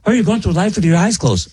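high-pitched mocking quote; mock critic. Host mimicking someone who objects to tuning out, mocking.

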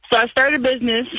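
ordinary talk

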